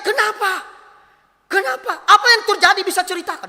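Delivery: very high, urgent questioning; shocked. Retold or imitated. Pastor hearing a young woman's confession, re-enacted shock. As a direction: ask it in acted alarm, voice leaping up. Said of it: "Saya kaget".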